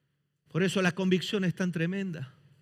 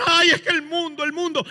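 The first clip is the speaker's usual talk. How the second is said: mocking whiny youth; 8 semitones higher, 6 dB louder, faster than usual. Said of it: "esa tontería que muchos jóvenes dicen".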